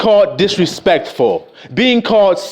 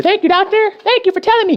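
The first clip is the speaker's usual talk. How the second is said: higher, grovelling thank-you to the doctor; mock-polite gratitude, pitched up; patient meekly thanking the doctor, higher; 10 semitones higher, faster than usual. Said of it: "You say".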